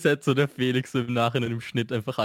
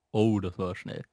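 ordinary delivery

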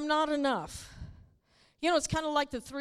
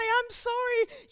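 high, slow little sorry; a hint of a fretting believer; mock apology; 8 semitones higher, slower than usual. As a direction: play a quick fretful apology. Acted quick apology.

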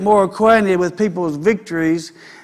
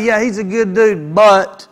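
knowing but. Retold or imitated. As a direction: leave the but hanging meaningfully. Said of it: "you ask about somebody".

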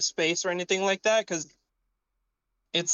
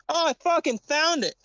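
sudden high, loud burst of relief; excited; 10 semitones higher, 4 dB louder than usual. Real, not acted. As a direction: yell out the find with delight. Finds the lost computer part.

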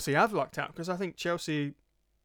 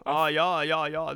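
put-on German accent, yeah said as ja; a hammed-up German ja ja; mock German accent; 6 dB louder, slower than usual. Co-host warming into his German accent. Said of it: "This is the German".

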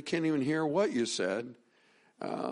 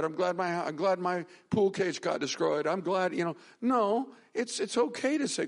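higher, pressing preacher voice; impassioned preaching; 8 semitones higher than usual. Acted. Pastor urging his flock to grieve freely, fervent preaching. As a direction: urge the congregation on, pitch raised. Preaching builds to raised pitch.

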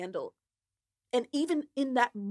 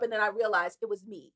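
normal talking voice

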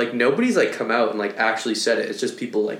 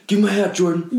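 quoting a Vine; 8 semitones higher than usual. Retold or imitated. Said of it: "he rolls up the window".

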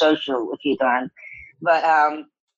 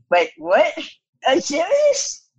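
incredulous; 9 semitones higher, slower than usual. Retold or imitated. Actress questioning director about kneeling all shoot, incredulous. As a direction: voice leaping up in disbelief. Retold disbelief at director's odd request.

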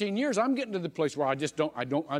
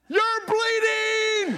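high drawn-out cry of alarm, louder; alarmed shout; 6 dB louder, much slower than usual. Retold or imitated. Girl turning round shouting to the preacher, shocked and loud. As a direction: stretch it slow, way up and loud. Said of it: "she says".

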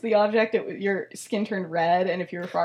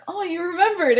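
raised, pleasantly surprised coo; mock touched surprise; 7 semitones higher than usual. She hears he avoided the exam day, playfully touched. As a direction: act out being touched, pitched up high.

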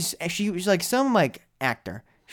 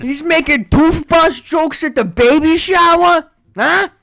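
high, slow, hammed-up yell of disbelief; outraged shout; 13 semitones higher, 9 dB louder, slower than usual. Relative outraged at toothbrush jokes, hammed-up slow shout. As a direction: bellow it in mock outrage.

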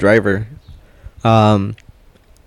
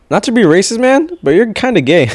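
much higher-pitched quote; imitated perfect clear English; 10 semitones higher than usual. Coworker suddenly speaks clear English on the belt, high imitation. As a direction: deliver it high, as a comic punchline. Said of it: "perfect, clear E-, English".

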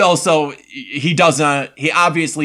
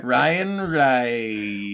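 slow drawn-out guess; 3 dB quieter, much slower than usual. Real, not acted. Guessing the surname.

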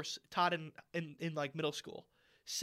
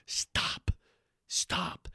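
imitated whispered reprimand; hushed scolding. Mom leaning over to her girl, whispered scolding. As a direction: lean in and whisper it like a tired mom.

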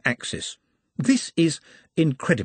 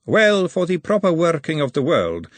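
Lady Margalotta; 7 semitones higher, 4 dB louder than usual. A narrator's reading. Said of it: "said Lady Margalotta".

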